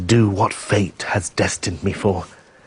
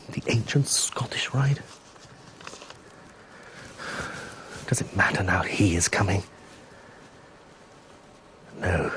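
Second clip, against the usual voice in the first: hushed, breathy whisper to himself; barely voiced, secretive murmur; 4 semitones lower, 8 dB quieter than usual. Pierre musing alone, secretive.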